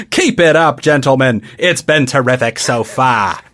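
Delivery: put-on Australian accent. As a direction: sign off in an exaggerated Australian accent. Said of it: "like an Australian Howard Cosell".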